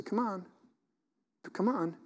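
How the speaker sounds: soft parental coaxing, imitated; very gentle. Parent guiding a child, very gently. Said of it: "very gently say".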